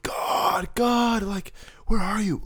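high, strained cry up to God; retold scream. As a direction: re-enact screaming out to the Lord. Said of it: "I was screaming out to the Lord".

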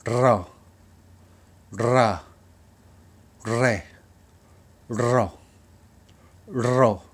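ロ, ラ, レ each with a loud tongue trill; rolled R drill. Shown as a demonstration. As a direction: model the trilled syllables for students. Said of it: "皆さんもやってみてください". (巻き舌で一音ずつ「ロ、ラ、レ」)

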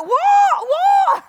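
high, loud bewildered yelp; child yelling back. Retold or imitated. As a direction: shriek it back like a confused toddler. Small child can't hear in gale.

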